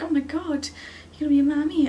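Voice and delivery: high, loud thrilled mum; mock delighted squeal. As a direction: gush over the news like a proud mother. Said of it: "She was like".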